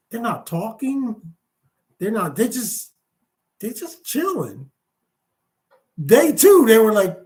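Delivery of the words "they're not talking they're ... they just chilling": softly voiced, halting report; puzzled spy's report. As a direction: play a baffled scout reporting quietly to his superior. Spy reporting back to commander.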